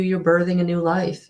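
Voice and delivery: ordinary talk, nothing marked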